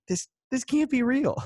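high, incredulous squeal of disbelief; a sky-high "this can't be real" in mock outrage. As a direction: pitch it way up in shocked disbelief. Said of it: "It's like".

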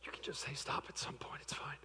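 soft whispered prompt; whispering. Real, not acted. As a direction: whisper the hint to her. Quiet prompt to volunteer.

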